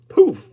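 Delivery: high, loud burst of a poof. Acted. The witch's brew explodes, loud high poof. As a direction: explode the word like a blast.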